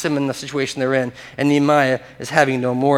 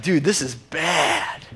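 dismayed thought; 4 dB quieter, much slower than usual. Retold or imitated. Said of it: "I'm just thinking the whole time".

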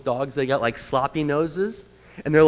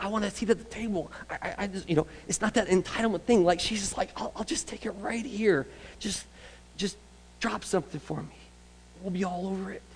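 higher, softer, quick and humble; humble eager woman; 5 semitones higher, 6 dB quieter than usual. Acted. Preacher voicing the woman content with crumbs, soft eager. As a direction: plead small and eager, asking crumbs.

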